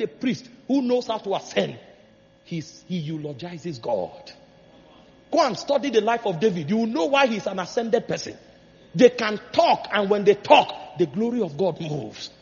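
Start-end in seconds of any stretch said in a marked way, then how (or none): none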